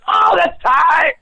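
high shouted delight, fan gushing; excited fan reaction. Retold or imitated. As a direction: squeal it out like a thrilled fan. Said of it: "Be like".

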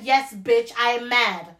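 normal talking voice

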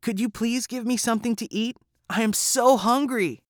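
put-on high little boy's voice, begging for food; hungry Jack pleading with the giant's wife. A narrator's reading. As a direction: ask sweetly like a hungry child. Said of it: "Jack said".

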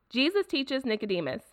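ordinary delivery

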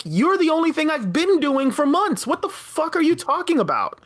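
retold angry retort. Retelling how she snapped back at the boyfriend, exasperated. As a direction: fire it back, half hammed. Her own reply in the fight.

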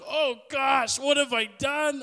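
loud, high-pitched dismay; mock horror. Heckler seeing a colleague cry, mock horror. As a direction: cry out in hammed-up guilty panic. Retelling panic at making someone cry.

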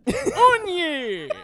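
laughing it out high. Real, not acted. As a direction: say it while laughing. Reading the translated word laughing.

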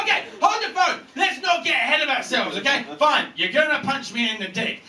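high, hammed-up bargaining; panicked negotiating. Victim stalls and negotiates with puncher.

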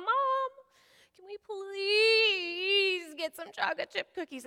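put-on high, slow, teary child's whine; whiny kid plea. Daughter begging mom for cookies, high and whiny. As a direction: beg in a drawn-out little voice. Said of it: "so she asks her mom".

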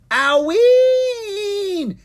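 very high, long drawn-out yell; yelled cry. A narrator's reading. Dragon whose tail is grabbed, yelling to her brother. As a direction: wail it out at full volume. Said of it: "she yelled".

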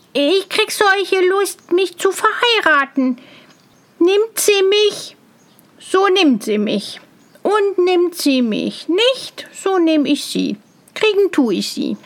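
high, singsong bumpkin voice; eager simpleton. A narrator's reading. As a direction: crow about marrying, high and gleeful. Tölpelhans excited about marrying.

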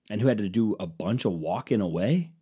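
ordinary talk, nothing marked

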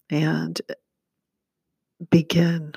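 a whispered cue to begin. Real, not acted. Start of guided meditation.

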